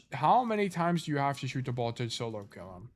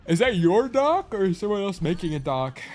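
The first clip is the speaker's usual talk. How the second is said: talking through a yawn; 6 semitones higher, 6 dB louder than usual. Real, not acted. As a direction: stretch the words with a yawn. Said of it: "Why am I yawning so much?".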